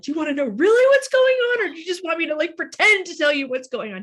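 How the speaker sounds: high, loud, worked-up pleading; retold hysterical outburst. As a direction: act out an overwrought challenge to the officer. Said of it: "like, sobbing hysterically".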